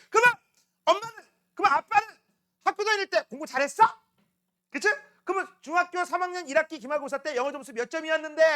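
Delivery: high-pitched mock challenge to a parent; indignant child retort. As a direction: fire back like a kid sick of being nagged. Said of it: "화가 난 거야". (발끈해서 높은 톤으로 따지는 아이 흉내)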